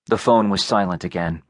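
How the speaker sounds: plain reading voice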